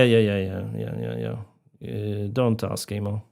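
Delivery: ordinary talk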